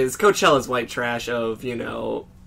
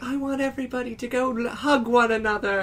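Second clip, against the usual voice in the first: much higher, softer dreamy hippie plea; 10 semitones higher, 3 dB quieter than usual. Retold or imitated. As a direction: coax the crowd gently like her. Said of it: "I'm being louder than she was".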